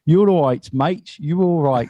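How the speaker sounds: high, mock English-accent greeting; bad English accent. Quoting a favourite bit of Buddy G, badly faked English accent. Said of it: "trying to do an English accent".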